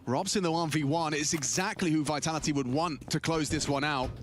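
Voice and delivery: rising play-by-play call; esports caster. Acted. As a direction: build the tension of the clutch. Live play-by-play of the clutch.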